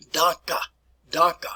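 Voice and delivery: "Danke" slowly modelled, like "dahn-kuh"; "Danke" demonstrated for learners; German pronunciation. Shown as a demonstration. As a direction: say "Danke" in two clear syllables. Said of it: "the German word here for thank you".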